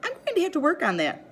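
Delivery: a hint of a cheerful teacher voice; teacher with a laugh. A narrator's reading. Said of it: "she says with a laugh".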